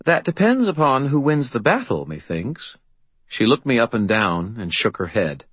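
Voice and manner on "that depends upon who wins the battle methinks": wry. A narrator's reading. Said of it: "Her voice turned wry".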